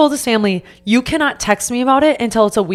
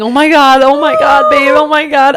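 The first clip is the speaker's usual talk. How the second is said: high, loud string of exclamations; overwhelmed repetition; 7 semitones higher, 7 dB louder than usual. Retold or imitated. Fiancée retelling the proposal to friend, loud stunned repeats. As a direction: reenact being too stunned to say anything else. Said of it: "I kept saying".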